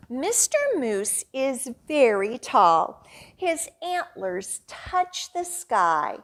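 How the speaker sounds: plain reading voice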